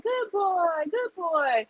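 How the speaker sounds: put-on high, sing-song "good boy" to a pet; praising a dog.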